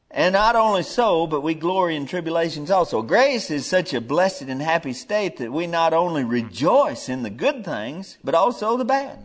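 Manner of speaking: loud, high preacher's build; fiery preaching. Acted. Preacher lifting his voice to the church, loud and high. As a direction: preach it out to the congregation.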